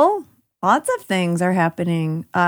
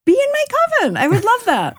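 excited. Real, not acted. Excited coven invitation, squealing.

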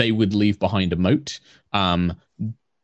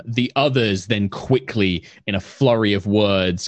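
ordinary talk, nothing marked

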